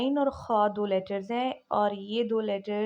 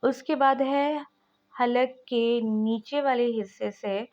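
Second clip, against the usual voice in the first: ordinary talk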